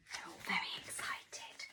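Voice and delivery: put-on whispered excitement to the children; hushed storyteller whisper. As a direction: breathe it like a secret.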